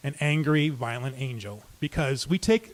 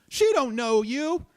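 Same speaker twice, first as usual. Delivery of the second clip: soothing, higher and slow; 8 semitones higher, slower than usual. Retold or imitated. Calming an armed, angry stranger.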